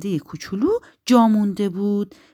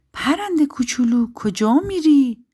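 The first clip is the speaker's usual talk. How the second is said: calling out kindly; 8 semitones higher, 6 dB louder than usual. Acted. Spruce calls to the departing bird, gently inquiring. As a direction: call after someone with a lifted voice.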